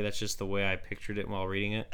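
ordinary talk

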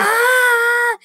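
loud high cry of pain; pain scream. Retold or imitated. Girl screaming when her sister's nail rips her arm. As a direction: scream as the nail tears skin.

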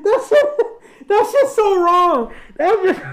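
high, slow laughing; cracking up in disbelief. Real, not acted. Cracking up at story.

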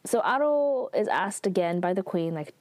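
usual speaking voice

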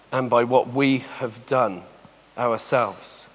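gentle searching sermon voice with long pauses. Acted. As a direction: let each phrase sink in, hushed.

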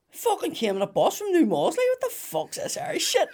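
hammed-up bus-trip grumble; mock outraged punter. Comic voices audience complaining about the Irish, mock outrage.

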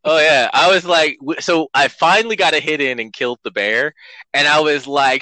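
ordinary talk, nothing marked

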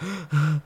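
sharp imitated gasp for air; breathless gasp. Climber telling how he lost his breath, gasping. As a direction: gasp as if winded at altitude. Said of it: "couldn't get my breath back".

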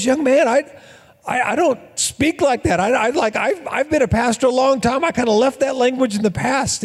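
a hint of a stuffy preacher correcting a kid; mock-proper pastor voice; prim pastor. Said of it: "And he goes".

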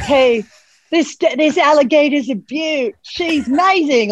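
excited Australian wildlife host, vowels stretched slow; Steve Irwin impression. Guest imitates the wildlife host spotting an alligator, Steve Irwin impression. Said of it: "a Steve Irwin impression".

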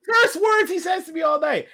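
loud, high indignant call; outraged shout. Real, not acted. Outraged recount.

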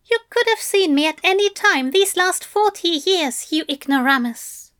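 high-pitched, indignant scoff; Aziraphale scoffing. A narrator's reading. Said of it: "Aziraphale scoffed".

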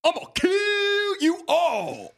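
high, slow Southern villain drawl; Southern accent. Shown as a demonstration. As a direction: draw it out Southern style. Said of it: "are you from the South".

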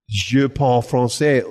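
slow, hesitant French with pauses; schoolboy French, stumbling; halting French. Retold or imitated. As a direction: grope for each French word. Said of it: "I should have said".

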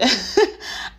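a quick bright laugh breaking out; a short amused laugh. Real, not acted. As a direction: laugh at your own joke. Laughs at own joke.

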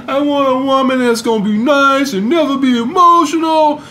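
lightly mimicked whiny guy; mocking guys. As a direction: quote them with light mockery. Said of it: "I don't wanna hear".

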